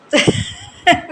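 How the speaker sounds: high, loud short giggle; sudden burst of giggling; short laugh. Real, not acted. Vlogger hears herself say खैर, sudden high laugh. Laughs after saying khair.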